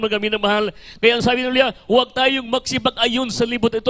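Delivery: ordinary delivery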